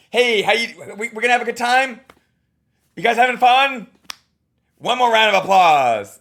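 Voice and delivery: high, loud, hammed-up hype; mock pushy host. Coach mocking a host's repeated pimps, hammed-up. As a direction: overpush the crowd. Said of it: "But if it's just like".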